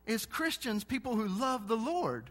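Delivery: high, eager voice of the cashier; light up with high excitement; excited cashier. Retold or imitated. Cashier Jonas exclaiming to the preacher, high and excited. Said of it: "He said".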